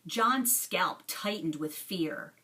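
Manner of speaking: plain reading voice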